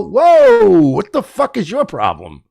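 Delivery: high, offended whoa; high, offended mock outrage; indignant innocent. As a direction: bristle at the insult. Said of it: "I go".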